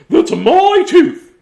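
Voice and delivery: high, slow, loud squeaky fairy; tooth fairy voice. Acted. Tooth fairy claiming the tooth, high and loud.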